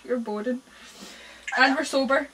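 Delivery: ordinary delivery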